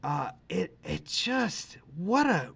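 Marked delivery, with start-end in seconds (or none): none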